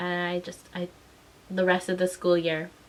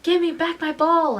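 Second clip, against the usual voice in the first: put-on higher, louder angry kid demand; 9 semitones higher, 5 dB louder than usual. Little kid to the older boy who took her ball, angry. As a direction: re-enact a child's angry demand. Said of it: "And I was angry".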